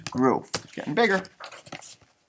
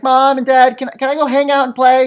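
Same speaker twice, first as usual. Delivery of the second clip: put-on a light child voice, a bit higher and louder; pleading chick; 6 semitones higher, 6 dB louder than usual. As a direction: ask mom and dad eagerly like a little kid. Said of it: "He was like".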